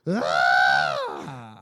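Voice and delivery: low loud excited shout. Real, not acted. Closing the show, a low loud excited shout. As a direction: let out a big excited yell.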